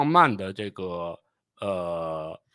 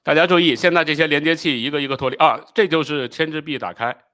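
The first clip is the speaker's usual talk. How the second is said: louder, higher, quick commentary; urgent live pointing-out; 4 semitones higher, 6 dB louder than usual. Real, not acted. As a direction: call viewers' attention as it happens. Live commentary over launch video. (提高嗓门急促地现场解说)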